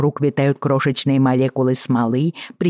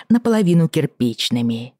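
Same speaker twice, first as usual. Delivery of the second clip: ordinary delivery, nothing marked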